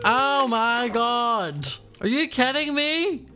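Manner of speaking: ordinary talk, nothing marked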